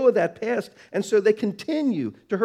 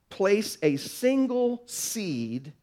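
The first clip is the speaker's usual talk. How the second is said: ordinary talk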